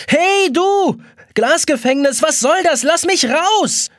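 loud, high desperate shouts; yelling. A narrator's reading. Trapped boy yells at the glass prison. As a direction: demand to be let out, slowing on "raus".

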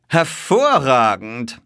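high, loud, drawn-out delight; exclaiming. A narrator's reading. Detective watching the race start, exclaiming. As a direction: draw it out admiringly, loudly. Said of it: "rief er".

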